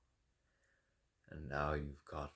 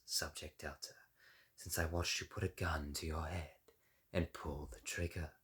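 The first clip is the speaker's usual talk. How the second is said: ordinary talk, nothing marked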